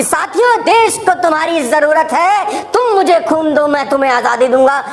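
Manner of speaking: fiery rallying oration, pitched high; Netaji Subhash Chandra Bose. Acted. Leader demanding blood for freedom from the public, impassioned. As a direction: rouse the crowd with burning intensity. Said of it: "अपनी आंखों में खून उतार के बोल रहे हैं".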